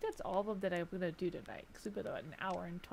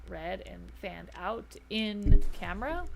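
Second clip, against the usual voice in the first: hitting the mic; 7 dB louder, slower than usual. Real, not acted. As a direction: hand bumping the microphone. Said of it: "I gotta quit hitting the mic".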